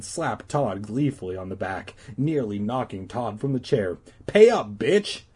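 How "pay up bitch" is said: high, loud demand to pay up. Acted. Todd demanding his bet money, loud and high. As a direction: bark the demand for payment. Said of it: "Todd demanded".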